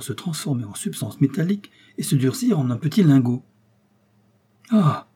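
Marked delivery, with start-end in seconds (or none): 4.7-5.1 higher, louder gasp of alarm; king startled by gold in his mouth; frightened cry. A narrator's reading. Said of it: "s'écria Midas avec effroi".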